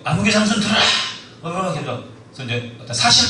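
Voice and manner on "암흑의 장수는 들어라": herald's proclamation. Retold or imitated. King's herald calling.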